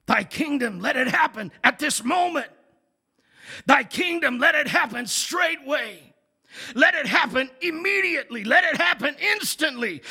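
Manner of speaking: rises to a high, loud, shouted plea; forceful, insistent prayer. Shown as a demonstration. Pastor shows the imperative sense of the prayer, forceful and shouting. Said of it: "it is said with a sense of forcefulness".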